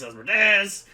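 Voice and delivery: Asmodeus belted out high and loud; loud dramatic Asmodeus. Shown as a demonstration. Playing with saying the name.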